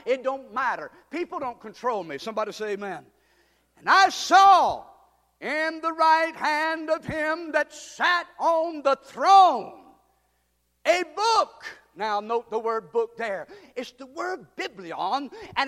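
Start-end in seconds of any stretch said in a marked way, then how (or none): 3.8-11.7 loud, slow scripture proclamation; prédication proclamée. Real, not acted. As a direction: ring out each phrase with drawn-out words. Preacher proclaims scripture at full force.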